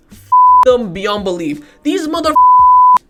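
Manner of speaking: slow, enraged yell climbing very high; furious shout. Real, not acted. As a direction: explode at the rivals. Swearing at rival club.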